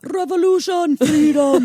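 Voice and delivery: high, loud Scottish battle cry; hammed-up Scottish war cry; Scottish accent. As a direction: roar it in a Scottish accent like Braveheart. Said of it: "in a Scottish accent".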